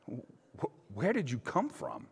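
soft, halting question; a touch of fear. A narrator's reading. Afraid Pilate quietly asks Jesus, softly and halting. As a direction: ask it unsettled and hushed.

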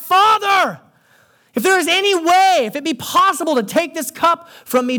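high, urgent plea acted from the pulpit; impassioned retold cry, easing gradually toward the end. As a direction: cry out in prayer, voice pitched right up. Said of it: "He cries out".

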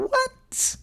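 high, drawn-out "what?"; incredulous squeal; astonished. Real, not acted. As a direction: burst out in high-pitched disbelief. Mock astonishment at undeserved blessing.

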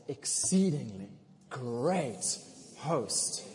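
Exceedingly, great, host each said slowly as its own word. Shown as a demonstration. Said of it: "look at somebody this morning and say".

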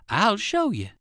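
a bird surprised by the question. Acted. The cat bird speaks.

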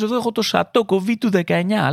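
lightly hammed-up fancy restaurant waiter; posh sommelier. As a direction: present it like a snooty sommelier. Posh waiter presenting wine.

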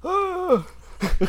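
high mock groan of someone winded; imitated pained groan. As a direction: groan as if punched in the gut. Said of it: "he's doubled over like".

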